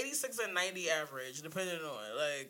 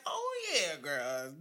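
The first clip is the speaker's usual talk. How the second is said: sassy admission; 7 semitones lower, much slower than usual. Retold or imitated. Confessing to mom, sassy.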